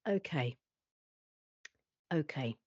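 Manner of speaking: soft, low, tense okay; quiet inner thought. Retold or imitated. Looking out the window, thinking uneasily to herself. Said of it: "all I was thinking".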